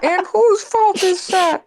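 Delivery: high, slow drawn-out cartoon line; YouTube poop quote. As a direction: stretch every word up high. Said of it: "I love that video so much".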